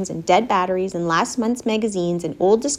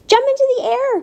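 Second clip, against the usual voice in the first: very high, excited command; crying out. A narrator's reading. Charlotte puts the pig through tricks, crying out. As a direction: cry out the order on a high pitch. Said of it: "cried Charlotte".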